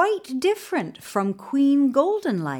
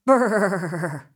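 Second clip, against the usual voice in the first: cold lips-trembling brrr; shivering brrr; 3 semitones lower than usual. A narrator's reading. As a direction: shiver it out gloomily. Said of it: "They just said".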